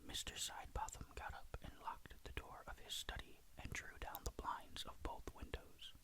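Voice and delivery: usual speaking voice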